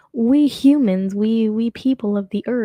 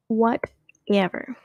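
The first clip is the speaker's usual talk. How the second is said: WHATEVER stretched out slowly, in two pieces; drawn-out whatever; much slower than usual. Pretend. Host brushing off a joke nobody liked, drawn-out mock dismissal.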